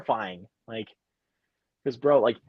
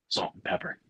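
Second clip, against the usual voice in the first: put-on whispered kid's answer; 10 dB quieter than usual. Shown as a demonstration. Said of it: "You gotta have the giggle in there".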